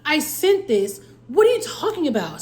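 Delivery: retold frustrated outburst. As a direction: push back in disbelief, pitch up. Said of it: "I'm frustrated".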